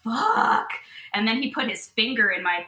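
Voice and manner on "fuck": high, sudden exclamation; retold shocked curse; startled curse. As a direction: blurt it out in shock. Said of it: "And I was like".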